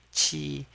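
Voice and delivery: qi high and level; first tone. Shown as a demonstration. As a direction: hold "qi" flat on a high note. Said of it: "with the first tone".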